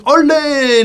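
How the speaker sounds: high, loud joyous olé; hammed-up corrida shout; mock bullring cheer. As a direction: cheer like a whole arena. Bullfight crowd cheer, mocked.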